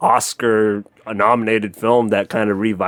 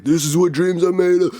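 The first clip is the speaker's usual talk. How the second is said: higher-pitched mock victory cry; victory screech impression; 7 semitones higher than usual. Friend recalling a player's famous victory screech, pitched-up imitation. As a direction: imitate a triumphant athlete, voice pitched up. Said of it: "like, victory screech".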